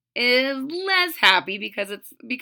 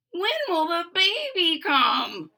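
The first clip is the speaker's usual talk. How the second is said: wondering aloud; 8 semitones higher, slower than usual. A narrator's reading. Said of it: "he wondered".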